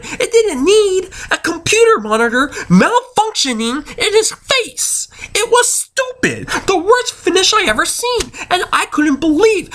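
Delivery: high-pitched outraged rant; outraged fan venting at a horrible finish; mad. Real, not acted. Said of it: "I was so mad".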